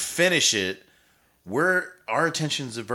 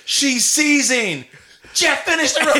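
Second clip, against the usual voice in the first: much higher, louder, slow; laughing mock shout; 9 dB louder, slower than usual. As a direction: holler it in disbelief while laughing. Mock bar reaction.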